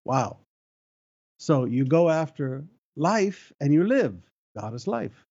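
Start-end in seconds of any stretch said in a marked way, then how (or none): none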